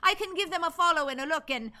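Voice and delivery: put-on improvised Irish accent, chatty. Streamer filling three requested minutes of accents, Irish accent. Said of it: "request his three minutes of accents".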